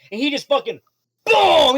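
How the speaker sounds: high, loud hyped shout on boom; excited shout. A narrator's reading. GM describing the shark's blow, shouting with excitement. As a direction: slam the word boom out. GM narrates shark smashing Kappas.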